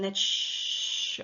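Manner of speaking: long hushing "shh" like the sea; ocean shush. Shown as a demonstration. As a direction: imitate the ocean with a drawn-out shh. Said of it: "the sound of the ocean".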